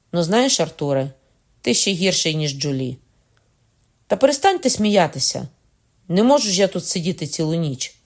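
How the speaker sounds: exasperated scolding. A narrator's reading. James orders Arthur to stop laughing, loud and exasperated. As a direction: snap at him to stop it.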